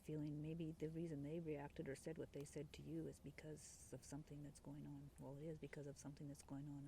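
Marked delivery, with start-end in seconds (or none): none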